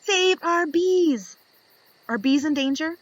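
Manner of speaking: ordinary talk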